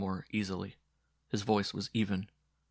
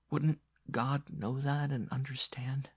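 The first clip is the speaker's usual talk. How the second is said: whispering; 6 semitones higher than usual. Acted. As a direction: breathe it out tenderly. Nun comforting dying boy.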